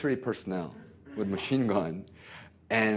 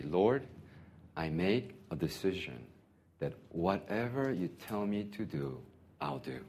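quiet prayer; 3 semitones lower, 7 dB quieter than usual. Pretend. Voicing a prayer to the Lord.

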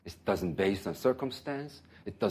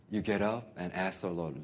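usual speaking voice

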